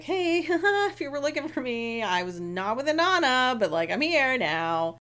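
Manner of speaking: high, laughing sing-song excuse; fake casual. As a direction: play it innocent, starting high and settling down. Retold character covering up, breezily.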